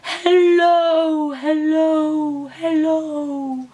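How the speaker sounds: put-on leading kids in an echoing hello, fading. Shown as a demonstration. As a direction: let each hello die away down the well. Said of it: "a big echo voice".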